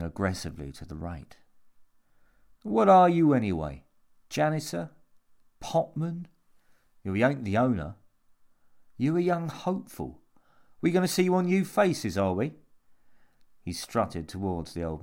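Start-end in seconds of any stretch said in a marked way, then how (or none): 2.6-12.6 high, mocking run of questions; cocky, taunting Jimmy. A narrator's reading. Jimmy sizes up the old man's job, cocky mockery. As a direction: needle him with pitched-up guesses.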